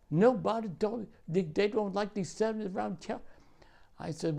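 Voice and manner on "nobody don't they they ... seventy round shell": mock defensive objection; retold protest. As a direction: imitate a kid protesting, pitched up. Newcomer protests when told to dig.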